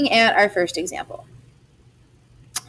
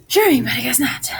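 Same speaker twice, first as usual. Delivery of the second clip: ordinary delivery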